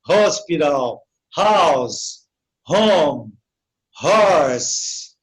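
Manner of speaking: "Hospital", "house", "home", "horse" each with a breathed H; aspirated English H. Shown as a demonstration. Teacher drilling H words, separated, falling "Hospital, house, home, horse". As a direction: sound H like a Brazilian R in "house", "horse". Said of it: "como se fosse um R".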